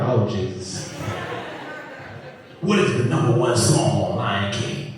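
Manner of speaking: low, deadpan quiz tone; low calm dad. Retold or imitated. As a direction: ask low and knowing. Dad asks, calmer.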